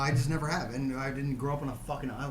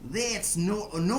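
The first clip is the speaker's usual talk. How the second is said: mock Aussie movie line; 5 semitones higher, slower than usual. Crocodile Dundee parody.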